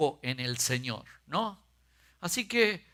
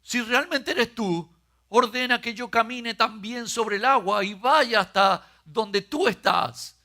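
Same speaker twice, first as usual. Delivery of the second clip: Peter asking; 6 semitones higher, 4 dB louder than usual. A narrator's reading. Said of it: "Pedro le respondió".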